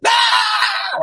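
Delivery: very high mock panicked shriek; hysterical shriek. Panicking mother shrieking at her runaway toddler, shrill mock scream. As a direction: let out a piercing over-the-top scream. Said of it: "She is spazzing on him".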